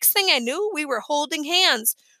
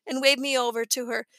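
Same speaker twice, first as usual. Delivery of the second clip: ordinary delivery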